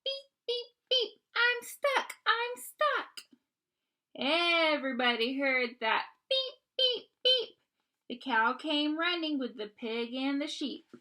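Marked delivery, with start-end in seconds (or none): 0.0-3.3 high, slow pleading little voice; high squeaky distressed beeps; high worried beeping. Acted. Little truck stuck, calling. 6.3-7.6 loud high horn beeps; bright high beeping. Acted. Echoing the truck's beeps.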